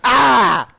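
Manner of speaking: loud, high cry of pain; short, sharp acted "Ah!"; pained yell. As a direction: yell out as if just hit. Character hit in face with chair.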